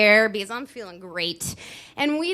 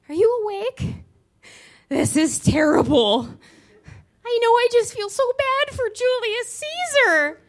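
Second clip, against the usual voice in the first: very high, loud fretful friend voice; distressed friend; 7 dB louder than usual. Retold or imitated. Roommate Christine whining late at night, very high. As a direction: fret in a squeaky high voice over Caesar.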